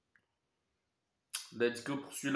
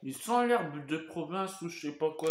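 chewing between words; talking while chewing. Real, not acted. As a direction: mouth partly full. Seen 1: Ate saucisson slice at -9; mouth still working while talking.

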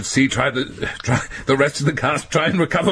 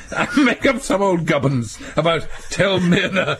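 usual speaking voice

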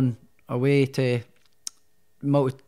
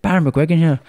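louder Irish self-introduction; attempted Irish accent; 2 semitones higher, 5 dB louder than usual. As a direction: announce yourself in a put-on Irish accent. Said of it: "this is my attempt at Irish accent".

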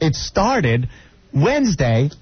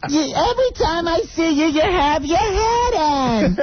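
high mock mother-in-law nag. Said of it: "then she's".

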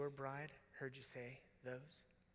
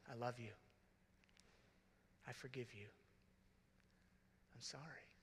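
soft sincere; 3 semitones lower, 12 dB quieter than usual. Shown as a demonstration. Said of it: "use those words a lot".

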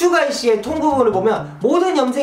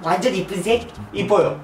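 ordinary delivery

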